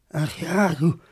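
ordinary talk

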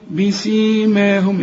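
"بسيماهم" slow, lips closed firmly on the mim, then the long aa held; careful tajweed reading of "بسيماهم"; "بسيماهم" with the alif stretched. Shown as a demonstration. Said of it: "تقول لي".

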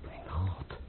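a faint, broken Mijn God fading away; despairing murmur. Acted. Prince losing hope before the count, faint despairing murmur. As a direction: barely breathe it out, hopeless.

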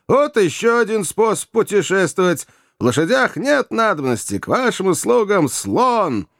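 high-pitched, jubilant exclaiming; delighted crying out. A narrator's reading. Joe celebrates travelling by elephant, crying out in delight. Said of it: "в восторге кричал Джо".

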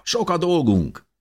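high, eager exclamation; enthusiastic rallying. A narrator's reading. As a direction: spur everyone on to work. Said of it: "mondta Dávid lelkesen tapsolva".

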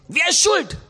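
high, loud accusation; accusing shout. Retold or imitated. Wife accusing husband of the crash, shouting. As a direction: demand who is to blame.

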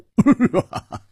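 high, loud burst of laughter; hearty laugh. Acted. Friend joking about hairy girl, laughing loudly. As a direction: laugh out loud at your own joke.

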